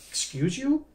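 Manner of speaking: high-pitched, rising incredulous question; mock taken aback, voice shooting up; retold indignant disbelief. Patient reacts to doctor's second issue, retold indignant disbelief.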